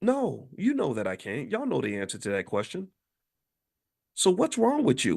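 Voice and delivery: ordinary talk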